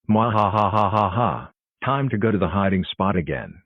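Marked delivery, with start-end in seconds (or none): 0.1-1.5 slow drawn-out villain cackle; slow evil laugh. Acted. Villain gloating.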